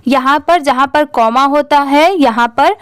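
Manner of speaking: ordinary delivery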